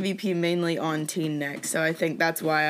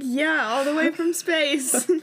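high, slow mock reply; 8 semitones higher than usual. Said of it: "And she's like".

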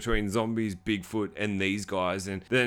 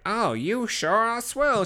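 much higher, slow goofy nerd voice; mock nerdy guy; 11 semitones higher, slower than usual. Vlogger imitates the nerdy guy's swooning: mock nerd voice. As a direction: drawl it like a dopey nerd. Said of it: "he's like".

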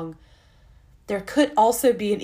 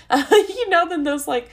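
high, loud, through a laugh; giggly, pitched well up; 11 semitones higher, 8 dB louder than usual. Real, not acted. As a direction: talk on while still laughing. Cracks up at own sales pitch.

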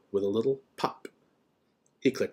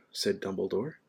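ordinary delivery, nothing marked

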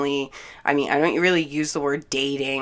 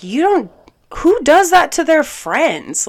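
high, loud, outraged question; indignant; 11 semitones higher, 8 dB louder than usual. Retold or imitated. Challenging him on how friends behave, indignant. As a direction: retell it as an exasperated challenge, pitch way up. Retold quarrel.

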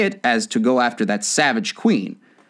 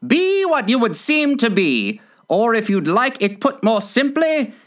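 high-pitched, sententious Duchess; moralizing Duchess; 7 semitones higher than usual. Acted. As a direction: reel off a tangled moral in a high voice.

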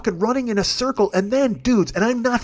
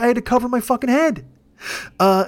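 normal talking voice

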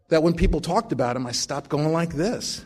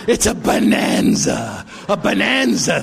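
put-on drunk dentist gloating; 4 semitones higher, 4 dB louder, slower than usual. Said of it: "He said".

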